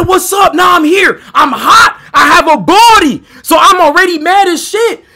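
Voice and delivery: high, loud and fired up; heated shouting. Retold or imitated. Storyteller reliving being hot and mad, heated shouting. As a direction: shout it like you're ready to fight.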